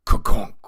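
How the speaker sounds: loud mouth-made gong clang; loud gong sound. Acted. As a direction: clang it like a bell. Game bell sound effect.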